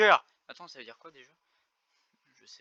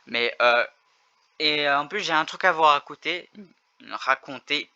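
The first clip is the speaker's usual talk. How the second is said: normal talking voice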